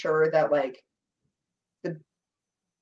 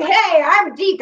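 high, loud mock cocky hello; mock cocky greeting; 9 semitones higher, 10 dB louder, slower than usual. Imitating Deke greeting her at the party, cocky and loud. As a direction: introduce yourself like a showoff. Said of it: "he was like".

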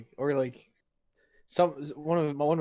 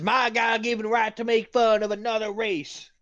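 high, loud mock-righteous proclamation; hammed-up declaration; 8 semitones higher, 4 dB louder than usual. Friend defends his right to make fun, hammed-up declaration.

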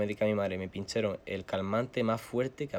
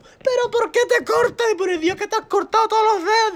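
friend screaming; 12 dB louder than usual. Retold or imitated. Zara keeps yelling.

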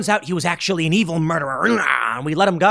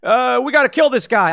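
higher, louder put-on mumble; a confused leader voice, hammed up; imitating a befuddled politician; mock old president; 8 semitones higher, 6 dB louder than usual. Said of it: "He's gonna come to you and be like".

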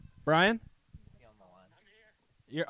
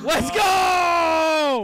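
cheering, much higher and louder; excited shout; 11 semitones higher, 8 dB louder than usual. Real, not acted. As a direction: celebrate the lucky spin. Winning the wheel spin.

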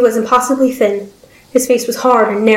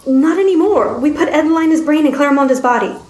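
higher-pitched, urgent character voice; raised-pitch, earnest appeal; scientist explaining; 6 semitones higher than usual. Acted. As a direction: insist on it as a driven scientist.

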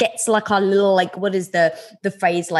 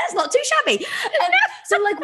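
high giggle through the words; laughing; 10 semitones higher than usual. Real, not acted. Pleased with her own phrase, laughing through it. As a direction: say it while still laughing.

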